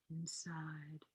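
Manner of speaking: breathy whispered cue; barely audible meditation whisper. Acted. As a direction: let the word fall to a whisper.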